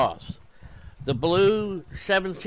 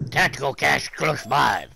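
louder parroting of a game character; mimicked game callout; 3 dB louder than usual. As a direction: echo it like an in-game announcement. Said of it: "That's what your guy just said".

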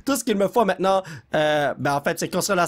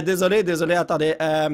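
ordinary delivery

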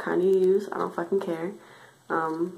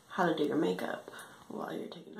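ordinary talk

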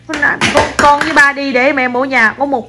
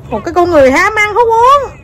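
mock scolding; 7 semitones higher than usual.

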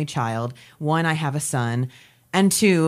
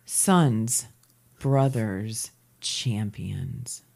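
slow, dropping lower with weighty pauses; tagline read; 7 semitones lower, much slower than usual. Acted. Host reads the movie tagline to co-hosts, measured announcer read. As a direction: read it like a trailer tagline, word by word.

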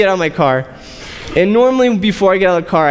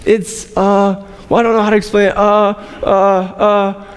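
stalling hesitation. Retold or imitated. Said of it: "I was like".

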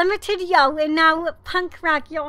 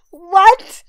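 1 stunned; 3 semitones higher, 12 dB louder than usual. Real, not acted. Surprise pull.

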